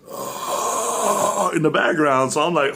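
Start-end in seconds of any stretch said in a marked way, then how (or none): 0.1-1.5 high faint ghostly ah; ghostly sigh. Retold or imitated. Heard in the background of the hotel, faint ghostly ah. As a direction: breathe out a far-off ghost sigh. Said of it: "I heard".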